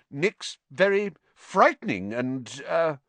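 usual speaking voice

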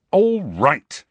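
Harry giving in angrily; angry. A narrator's reading. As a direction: give in with barely held anger. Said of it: "he said, angrily".

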